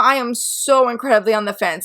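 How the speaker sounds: usual speaking voice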